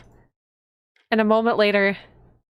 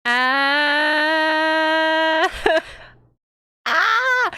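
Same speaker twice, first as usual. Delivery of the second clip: long, high rising wail; drawn-out scream; 9 semitones higher than usual. Real, not acted. Player seeing the blood-stained knife, long high scream. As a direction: scream high and long, then a short one.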